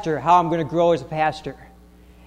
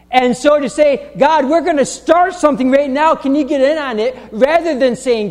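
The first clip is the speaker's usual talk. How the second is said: rising, louder preaching climax; preaching at full force; 6 semitones higher, 3 dB louder than usual. Real, not acted. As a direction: build the sermon to a peak. Preaching building up.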